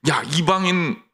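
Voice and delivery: normal talking voice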